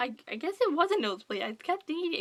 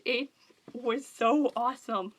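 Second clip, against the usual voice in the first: ordinary delivery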